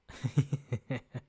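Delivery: short real laugh; real chuckle. Real, not acted. Trivia host reading a funny guess, real chuckle. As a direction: chuckle to yourself.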